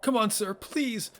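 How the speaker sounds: high-pitched acted plea; desperate pleading. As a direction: beg a fallen superior to hang on. Begs fallen general to revive.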